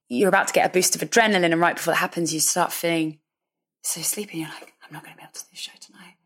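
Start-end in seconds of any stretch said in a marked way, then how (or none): 3.8-6.2 drowsy, whispered, barely there; sleepy whisper. Retold or imitated. Singer whispering her pre-show exhaustion to the interviewer. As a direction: whisper it like you can barely stay awake.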